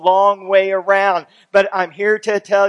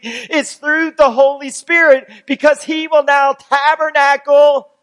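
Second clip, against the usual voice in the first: high, emphatic sermon delivery; impassioned preaching; 7 semitones higher, 6 dB louder than usual. Real, not acted. Preacher tells congregation the Spirit is in them, impassioned preaching. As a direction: preach it out with raised pitch, stretching key words.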